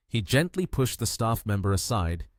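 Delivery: plain reading voice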